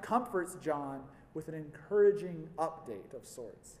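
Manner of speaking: ordinary talk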